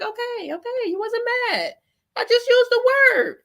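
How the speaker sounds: high sarcastic concession; sarcastic mock. Streamer mocking the host's denial, sarcastic. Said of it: "I'm like".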